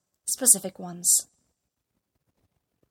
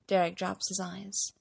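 usual speaking voice

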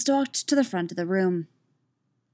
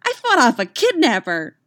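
put-on loud, higher, boasting child; proud; 6 semitones higher, 8 dB louder than usual. A narrator's reading. Katara reporting to her coach, proudly. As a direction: announce it like a proud kid. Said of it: "she said proudly".

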